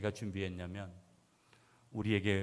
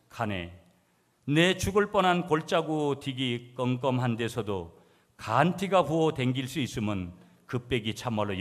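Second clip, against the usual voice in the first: emphatic Gyeongsang-dialect recitation; 3 semitones higher, 7 dB louder, slower than usual. Shown as a demonstration. Preacher reads second psalm version to congregation, in Gyeongsang dialect. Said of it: "경상도 버전 이 번".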